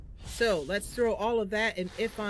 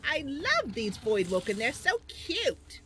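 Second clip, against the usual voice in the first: high-pitched gushing little character voice; excited, sugary high voice; Mister Buns, delighted; 8 semitones higher, 4 dB louder than usual. Acted. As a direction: squeal with glee, stretching the last word. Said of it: "Mister Buns is like".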